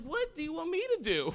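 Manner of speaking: high, laughing exasperation; mock helpless cry of a swamped boyfriend; exasperated. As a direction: throw up your hands in disbelief. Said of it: "I'm like".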